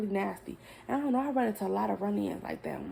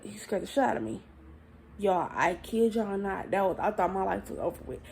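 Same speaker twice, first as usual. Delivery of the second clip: ordinary talk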